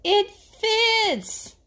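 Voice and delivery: high, loud delighted cry; a raccoon thrilled with his find; high gleeful shout as Adam; excited shout. Acted. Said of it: "Adam shouted".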